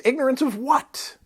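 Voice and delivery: pitched sharply up, questioning bird-demon; high-pitched demon bird asking. Acted. Reads the bird's question.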